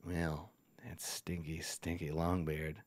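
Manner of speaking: very low, hushed goblin confidence; conspiratorial. Acted. Goblin boss offers visitor a secret look, conspiratorial. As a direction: drop right down, sharing a sly secret. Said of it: "he says to you conspiratorial".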